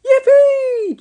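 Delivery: very high, loud cheer; boy cheering at burgers. A narrator's reading. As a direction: whoop with joy. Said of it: "cheered Norman".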